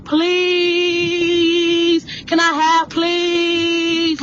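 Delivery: high, drawn-out pleading beggar voice; mock begging. Shown as a demonstration. Demonstrating what begging sounds like, high and pleading. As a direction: beg it out, stretching the please high. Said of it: "Begging is".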